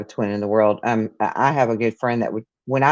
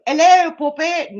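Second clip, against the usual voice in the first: very high, loud silly greeting; put-on phone voice; 8 dB louder than usual. Woman jokes to unknown caller on phone, high put-on voice. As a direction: sing out a hello in a silly character voice.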